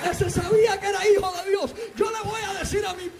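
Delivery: high, impassioned shouted sermon; fervent high-pitched preacher's cry; full-force preaching. Real, not acted. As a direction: preach it at the top of your range, driving each phrase home.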